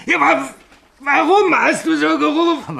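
very high, loud, hysterical questioning; frantic demand. Acted. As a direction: demand an answer in near panic. Frantic accusation of his friend. (hysterisch und laut fordernd)

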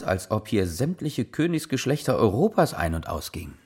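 normal talking voice

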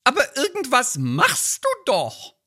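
hohe, drängende Ehefrauenstimme; nörgelnde Ehefrau. Acted. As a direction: den Mann antreiben. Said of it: "so ein Lieblingstext". (Ehefrau drängt ihren Mann, hohe Stimme)

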